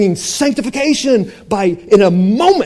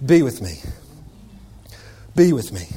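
much softer, low and gentle; soft intimate Jesus; 9 semitones lower, 7 dB quieter than usual. Acted. Jesus calling his disciples close, soft and gentle. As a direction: hush it like a tender call.

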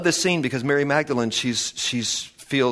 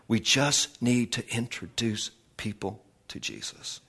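spaced out and softened, trailing down; hushed emphasis; 2 semitones lower, 7 dB quieter than usual. Real, not acted. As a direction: let the key line sink in quietly. Final repeat, slowed and softened.

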